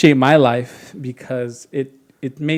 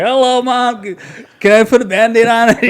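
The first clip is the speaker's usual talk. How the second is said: put-on higher, louder little-boy voice; innocent kid asking mom; 8 semitones higher, 5 dB louder than usual. Bleeding boy asks his freaked-out mom, innocently. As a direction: play the sweetly oblivious bleeding kid.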